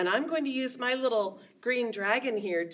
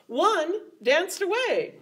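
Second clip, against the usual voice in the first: usual speaking voice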